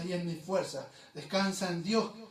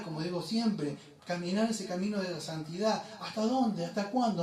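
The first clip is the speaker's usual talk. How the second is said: ordinary delivery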